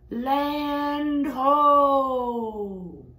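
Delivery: high, loud cry from the crow's nest; lookout's shout. Acted. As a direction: call it out across the ship.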